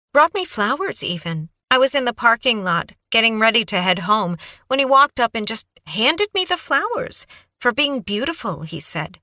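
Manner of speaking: put-on high, breathless young woman babbling on; Kaylee's eager voice. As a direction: gush it out like a nervous, eager witness.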